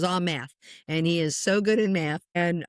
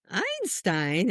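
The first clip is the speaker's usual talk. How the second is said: drawn-out word with a questioning rise; mother's startled question. Retold or imitated. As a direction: echo the name in disbelief. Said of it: "and I'm like".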